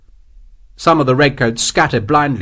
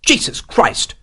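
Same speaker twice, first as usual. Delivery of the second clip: swearing; 10 semitones higher, slower than usual. A narrator's reading. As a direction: curse in startled frustration. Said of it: "Morris swore".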